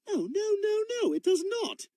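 high-pitched contradiction; exasperated, high denial; indignant protest. Acted. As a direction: object fussily, voice up high. Indignant correction of toy.

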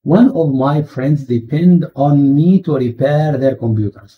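very slow, word-by-word exercise read; slow reading aloud. Shown as a demonstration. Teacher reads the textbook sentence slowly to students.

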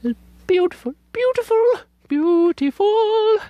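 swooning over beauty; building rapture. Real, not acted. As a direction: let each beautiful climb, stretch the last. Gushing over the paper.

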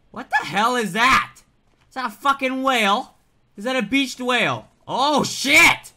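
loud, high-pitched startled questions; alarmed astonishment. Real, not acted. Gamer spots a beached whale, alarmed astonishment. Startled by huge creature in game.

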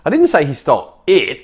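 "it" set apart and punched at the end; stress on IT. Shown as a demonstration. Coach showing something else was stolen, stressing "it". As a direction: save the weight for the final "it". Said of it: "inflect on the it".

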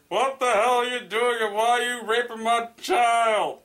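high, loud shouted challenge; hammed-up furious dad confronting an intruder; outraged father. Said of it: "My dad walked in and questioning".

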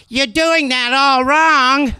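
high, drawn-out woman's scolding; heckling woman. Retold or imitated. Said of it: "this woman goes".

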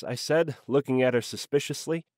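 plain reading voice

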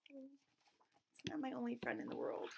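unvoiced, confiding aside; whispered correction. Teacher telling the kids it isn't true, whispered aside. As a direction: whisper the truth to the class.

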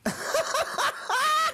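sudden squealing laugh; high laugh. Acted. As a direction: burst out in a high-pitched laugh. Interviewer laughs at own joke.

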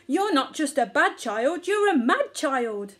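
loud, indignant telling-off; scold, slowing on the last words; angry scolding. A narrator's reading. Mother elephant to her naughty child, angry scolding.

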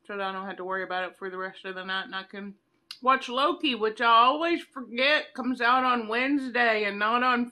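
speaking between bites; talking while chewing. Real, not acted. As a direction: mouth partly full of ice cream. Seen 3: Bites bar at 0.2-1.0, then talks chewing, bar held.

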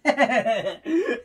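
a big burst of real laughter; loud laugh. Real, not acted. Vlogger laughing at her own [name] joke, loud laugh.

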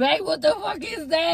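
high-pitched startled demand; alarmed outburst. Real, not acted. Asking partner what the pink stuff is, alarmed.

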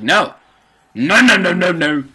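quick-fire imitated objection; insistent refusal. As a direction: wave off all concern in a friend's voice. Acts out friend refusing help.

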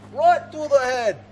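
put-on high, drawn-out Australian accent. Archer celebrating a good target hit, Australian accent. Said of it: "have an Australian accent".

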